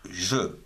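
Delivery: je, sounded out on its own; syllable said alone. Shown as a demonstration. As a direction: say je as a separate, clear syllable. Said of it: "G E".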